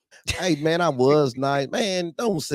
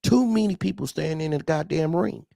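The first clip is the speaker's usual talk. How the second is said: usual speaking voice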